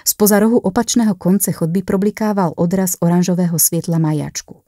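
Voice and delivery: plain reading voice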